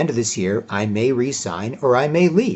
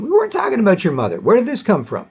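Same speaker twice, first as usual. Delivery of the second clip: high, fast baffled protest; a hammed-up baffled boyfriend; mock exasperated reply; 10 semitones higher, faster than usual. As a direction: toss it back quick and high. Boyfriend answers in argument.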